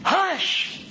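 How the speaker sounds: high, forceful hush; stern, sharp call for silence; severe hush. Acted. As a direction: burst out with a commanding hush. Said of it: "much more severe and solemn".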